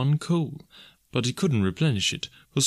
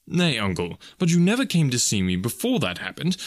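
high, earnest remonstrance; protesting nephew; 8 semitones higher than usual. A narrator's reading. Nephew questioning his uncle's excuse, protesting. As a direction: reason with him, pitch climbing.

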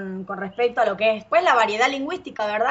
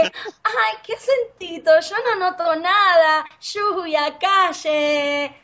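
higher, playful Argentine voice, lluvia and calle with the sh sound; coy Argentine accent; 7 semitones higher, much slower than usual. Retold or imitated. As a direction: flaunt the Argentine accent while acting innocent. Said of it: "y yo como que".

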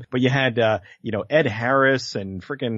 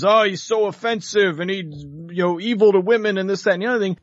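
slightly higher, mock-offended complaint; mocking protesters; 4 semitones higher than usual. Imitating protesters who got the movie pulled, mock indignation. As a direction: lightly mimic an indignant objector. Mimicking protesters objecting to comedian.

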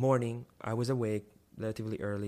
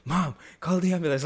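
higher and louder, calling out in alarm; urgent call; 6 semitones higher, 7 dB louder than usual. Retold or imitated. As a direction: shout up the stairs for help. Emergency, running upstairs to mom.

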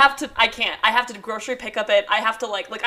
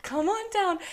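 higher, gentle coaxing coo; coaxing baby talk; 8 semitones higher, 3 dB quieter, slower than usual. Retold or imitated. Parents coaxing a trapped bird out, gentle cooing. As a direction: lure it down softly.

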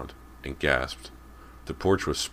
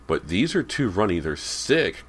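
higher, emphatic complaint; emphatic declaration; 5 semitones higher, 5 dB louder than usual. A narrator's reading. Girl rejecting runny eggs, declaring it emphatically. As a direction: declare it in loud disgust. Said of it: "Cody declared".

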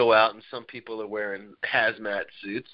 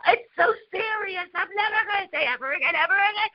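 mock child wailing. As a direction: play the four-year-old, indignant and sobbing. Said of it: "She's like".

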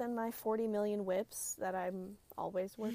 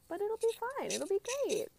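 high, mock-cheery reassurance; sarcastic; 12 semitones higher than usual. Real, not acted. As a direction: fake brightness, clearly ironic. Ironic about three weekends.

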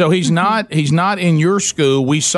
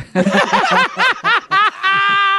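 high-pitched helpless cackle; big laugh; 14 semitones higher than usual. Real, not acted. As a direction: crack up in a long high laugh. Cracks up at own nickname joke.

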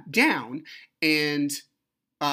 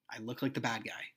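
much softer, resigned repeat; quiet resignation; 3 semitones lower, 9 dB quieter than usual. Retold or imitated. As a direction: let it sink to a low volume. Same line repeated, dropping away.

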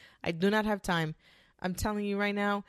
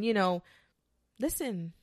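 ordinary talk

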